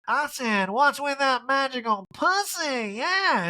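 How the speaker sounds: high, slow, drawn-out mock Erica. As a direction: camp it up, pitched high and slow. Erica voiced in recap.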